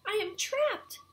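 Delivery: high, loud storybook wail; distressed cry. A narrator's reading. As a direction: cry out in hammed-up dismay. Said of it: "he cried".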